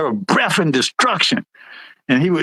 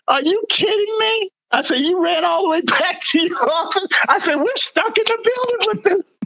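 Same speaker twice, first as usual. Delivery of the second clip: high, squeaky indignation, half laughing; outraged disbelief; 11 semitones higher than usual. Retold or imitated. Sergeant retells arguing with boss who ran off, outraged. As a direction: protest with voice soaring in disbelief.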